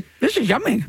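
talking while chewing; chewing gum. Real, not acted. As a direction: mouth full of gum. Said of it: "look super weird chewing gum".

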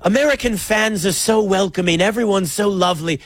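put-on light, quick British accent; a bad but cheerful British accent. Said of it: "I'm just gonna do a British accent the whole time".

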